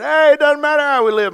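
very high, loud mock holler; careless church members. Paul's church shrugging off sin, a high loud mocking yell. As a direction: whoop it out in a put-on, heedless voice. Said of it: "saying to this church, they said".

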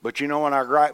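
normal talking voice

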